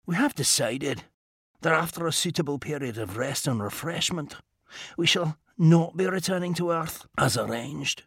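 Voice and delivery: quiet, dry and precise; a composed inventor gently scolding; calm Scottish gentleman. Acted. Mackenzie character dialogue.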